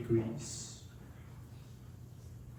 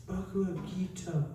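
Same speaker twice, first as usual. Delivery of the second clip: softer, hushed and reverent, clutching it close; tender murmur; 4 semitones higher, 5 dB quieter than usual. Retold or imitated. Old woman hugs the book to her chest, soft and moved. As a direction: say it gently like holding something precious. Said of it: "And she says".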